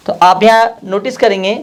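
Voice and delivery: ordinary delivery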